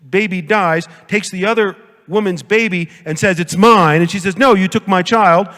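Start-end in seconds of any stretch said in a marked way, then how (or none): 3.4-4.0 mock claim. Two mothers fight over baby. 4.4-5.5 mock protest. Second mother's protest.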